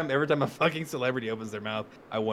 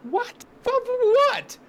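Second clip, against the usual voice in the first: very high, louder disbelief; high squeaky incredulity; incredulous yelp; 4 dB louder, slower than usual. Real, not acted. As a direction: shriek it in disbelief. Incredulous reaction.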